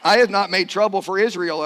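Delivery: loud, declamatory prophet; Elijah. Acted. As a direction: proclaim it as the accused prophet answering back. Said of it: "I get to be Elijah".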